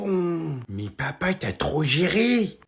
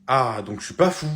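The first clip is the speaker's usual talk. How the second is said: normal talking voice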